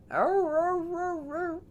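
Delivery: very high yowling howl; high dog ow-row howl. Acted. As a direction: howl like a sad shaved puppy. Dog howls again.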